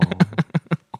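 a real laugh climbing upward; rising laugh. Real, not acted. Amused by the card-name joke, rising real laugh. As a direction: let the laugh rise at the end.

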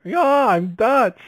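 high, slow, hammed-up Dutch voice; Dutch accent. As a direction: ham up a silly Dutch accent. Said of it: "Right, like".

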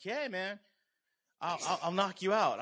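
high-pitched imitation of a confrontational guy; mock threat. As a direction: act out a guy squaring up. Acting out guy threatening him.

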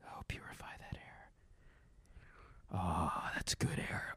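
whispered aside to himself. Real, not acted. Host turning on his air purifier, whispering. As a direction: breathe the words under your breath.

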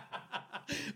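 wild breathy cackle; maniacal laugh. Real, not acted. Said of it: "Why are you laughing like that?".

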